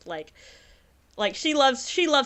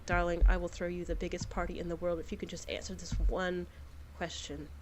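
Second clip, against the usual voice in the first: low soft noble; 3 semitones lower, 13 dB quieter than usual. Acted. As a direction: ask the grave question tenderly. Noble gently questions the hostess.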